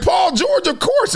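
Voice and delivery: high-pitched excited mock shout; excited yell. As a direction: shout it like it's obvious. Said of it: "I was like".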